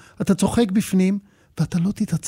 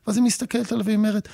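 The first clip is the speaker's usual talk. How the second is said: usual speaking voice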